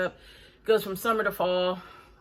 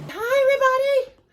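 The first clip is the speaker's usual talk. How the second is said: squeaky high hello with the dog in her arms; high greeting; 7 dB louder than usual. Real, not acted. Holding the dog, she greets viewers in a much higher, louder squeal.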